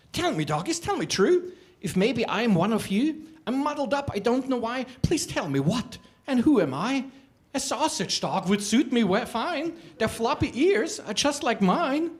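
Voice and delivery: high, eager begging voice; pleading little creature. Acted. Little creature begging the dogs, high and eager. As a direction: beg the dogs, pitched high.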